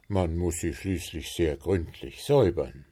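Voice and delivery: usual speaking voice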